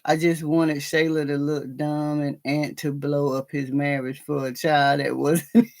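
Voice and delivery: usual speaking voice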